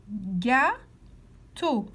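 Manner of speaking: "Gâteau" drawn out in two separated syllables. Shown as a demonstration. Teacher showing pupils the last picture, "Gâteau" syllable by syllable. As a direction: pause clearly in the middle of "Gâteau". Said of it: "Colorie la case où on entend".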